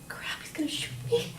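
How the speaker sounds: voiceless, hushed panic; terrified whisper. Retold or imitated. Victim turned away from gunman, re-enacted frightened whisper. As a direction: whisper it to yourself in dread.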